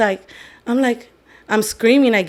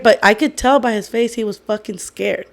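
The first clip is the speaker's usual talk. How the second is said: ordinary delivery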